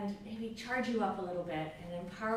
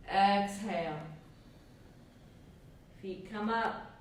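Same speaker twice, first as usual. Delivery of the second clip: breath coaching cues. Acted. Leads breath of fire rounds.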